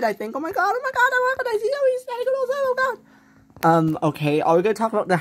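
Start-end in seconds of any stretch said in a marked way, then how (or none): 0.3-3.7 put-on high, fast gushing girl voice; high squealing excitement. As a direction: squeal with delight. Girl recognizes old classmate.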